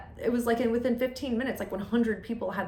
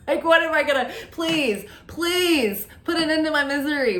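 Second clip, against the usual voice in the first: higher, louder, hammed-up begging; mock pleading; 6 semitones higher, 5 dB louder than usual. Creator daring critics to cancel their channel, mock pleading.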